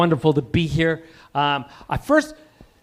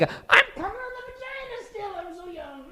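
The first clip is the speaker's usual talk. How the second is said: much higher, airy mock voice; mock young face; 8 dB quieter than usual. Comic mocking plastic surgery fans, in a high squeaky voice. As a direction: squeak it out like a fresh-faced surgery patient.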